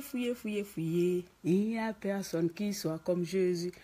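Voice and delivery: usual speaking voice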